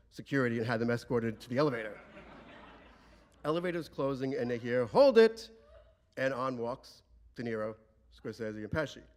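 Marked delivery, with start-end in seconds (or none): 4.9-5.5 high, loud, sudden shout; calling out. Retold or imitated. Someone calling to the closing elevator, urgent shout.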